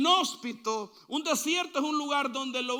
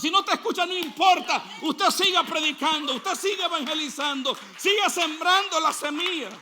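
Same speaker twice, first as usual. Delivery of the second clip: higher, loud rallying preaching; fervent shouting preaching; 3 semitones higher, 5 dB louder than usual. Acted. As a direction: urge them on, raising the voice. Predica con fuerza, alto. (predicación exaltada, casi gritando)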